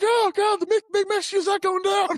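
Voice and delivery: very high, loud alarm; hammed-up high-pitched panic; mock panicked yell. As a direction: act out frantic disbelief. Acted alarm as taser fails.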